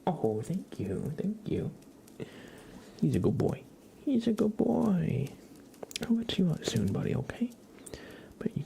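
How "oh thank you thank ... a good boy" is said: normal talking voice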